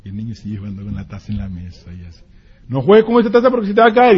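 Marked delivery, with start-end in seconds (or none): 2.7-4.2 faster, louder, fed-up repeat; impatient repeated warning. Retold or imitated. Parent repeating the cup warning, fast and irritated. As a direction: rattle it off in irritation.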